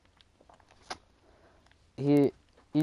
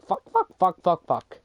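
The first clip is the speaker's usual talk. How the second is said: rapid-fire repetition, raised and loud; higher-voiced mock chanting; imitated gleeful parroting; 6 semitones higher, 6 dB louder than usual. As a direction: mimic a kid parroting a new swear. Imagined classmate repeating a new swear.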